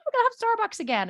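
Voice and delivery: high, loud, quick squeal of joy; imitated excited little girl; happy child cheering. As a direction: cheer like a kid getting a treat. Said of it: "she was so happy".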